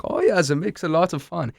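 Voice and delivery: high-pitched imitation of a German student's English; German accent. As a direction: mimic your German student, pitched well up. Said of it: "he recognizes when he says it".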